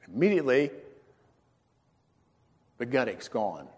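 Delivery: ordinary talk, nothing marked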